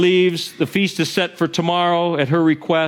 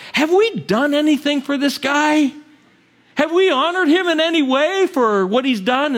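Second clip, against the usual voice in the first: lightly voiced king, higher and a touch urgent; the king asking; 10 semitones higher than usual. King asking servants about Mordecai, mildly pressing.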